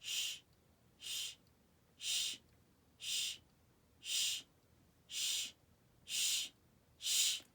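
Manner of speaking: soft unvoiced shushes in steady beats; eight shushes. Shown as a demonstration. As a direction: shush eight times, evenly paced. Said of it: "you're shushing one of your friends".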